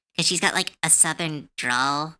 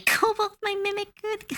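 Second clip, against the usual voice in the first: delighted squeal. Real, not acted. Delighted at a goblin idea.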